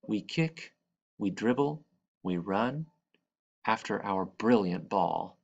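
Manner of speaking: ordinary delivery, nothing marked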